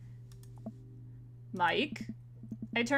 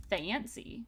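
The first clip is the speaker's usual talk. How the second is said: ordinary delivery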